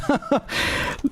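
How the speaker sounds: soft, high chuckle; short breathy laugh; teasing laugh. Real, not acted. Laughs after teasing the audience.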